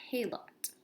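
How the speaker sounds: ordinary delivery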